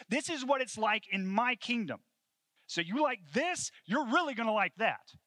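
high, loud proclamation; emphatic invitation. Acted. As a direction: proclaim it as a rousing promise. Voicing Jesus' invitation to his kingdom.

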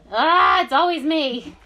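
loud, high mock wail; playful protest. Real, not acted. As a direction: cry out in joking complaint. Playful protest at being teased.

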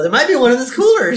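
helpful wife. Retold or imitated. Wife offering her husband the cooler, helpful. Voicing Marge offering sandwich.

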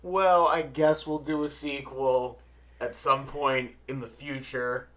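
slow, measured mock announcement; public service announcement. Teasing co-host's reluctant sequel promise, slow announcement voice. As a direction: drag it out like a reluctant official notice. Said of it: "like that public service announcement".

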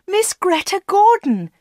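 high-pitched shout up the stairs; excited shout. A narrator's reading. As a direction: shout the star's name, pitch way up. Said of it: "shouted Anna".

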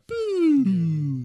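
long, slow, loud boo like an air horn; imitated booing horn. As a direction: blow a long booing whistle sound. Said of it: "one of those whistles".

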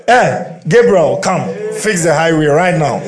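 God hollering for Gabriel; loud command. Acted. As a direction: shout the order across heaven, half laughing. God orders Gabriel to build highway.

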